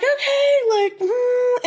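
very high, drawn-out wondering; sing-song high musing; mock intrigue. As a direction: pitch way up as if intrigued. Retells own intrigued reaction to psychic.

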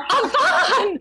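high, delighted call through a laugh; excited cry of a name. Retold or imitated. Spotting a friend by the elevator, calling out her name with delight.

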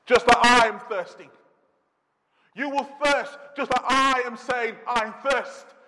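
high, loud, soaring sermon voice; impassioned preaching. Acted. Preacher crying out Jesus' thirst, loud and impassioned. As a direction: cry it out from the pulpit.

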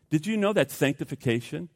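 ordinary talk

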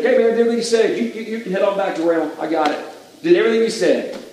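high, breezy, hurried mock excuse-maker; over-casual reassurance. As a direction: brush him off as if everything's handled. Saul hurriedly reassuring the approaching prophet.

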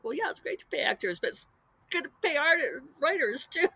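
a hint of exasperated sarcasm; sarcastic retort. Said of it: "And it's like".

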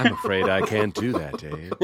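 calm, even computer voice; lightly voiced HAL; HAL impression. Player mocking the accentless stranger as HAL, calm and even. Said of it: "It just sounds like Hal".

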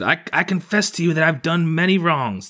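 small high creature voice; Kappa. A narrator's reading. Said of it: "Then the Kappa said".

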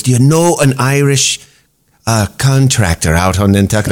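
mock Irish accent, lightly done. Comic quoting Ardal asking about contractors, mock Irish accent. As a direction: ask it in a playful Irish brogue.

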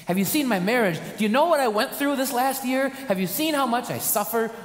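high, loud pleading cry; rising despair toward God; hammed-up desperate plea; anguished complaint.

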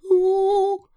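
very high, loud, drawn-out "ooh"; rising "ooh". A narrator's reading. Piglet going up in the air in the pocket, high "ooh". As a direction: hoot a long high "ooh". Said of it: "as he went up in the air".

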